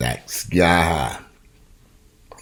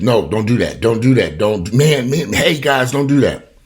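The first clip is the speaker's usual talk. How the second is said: higher, louder repeated shout; retold yell; 7 semitones higher, 6 dB louder, slower than usual. Store employee yelling at thieves running out, loud. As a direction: call out to stop them leaving. Employee yells at fleeing shoplifters.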